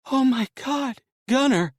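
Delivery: high, tearful woman's voice; overjoyed wife's high voice. Acted. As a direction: cry out in joy as the wife.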